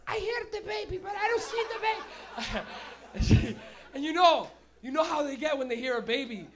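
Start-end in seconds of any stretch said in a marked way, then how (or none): 0.1-2.7 high, lightly voiced old lady asking; old Italian lady. Old Italian lady on the subway looking for the baby, high-pitched. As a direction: peer around like a puzzled grandma. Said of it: "I could just see her going".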